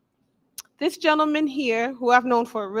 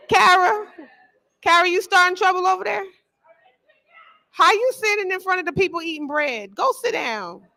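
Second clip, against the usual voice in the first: mom voice; 5 semitones higher, 3 dB louder than usual. Real, not acted. Host scolds Kara for eating bread up front, in mom voice. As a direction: call her out like a mother catching trouble. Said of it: "I done brought the mom voice out".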